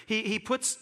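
ordinary delivery

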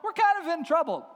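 high, loud mock plea; crying out. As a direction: call up to heaven in comic desperation. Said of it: "cry out to the Lord saying".

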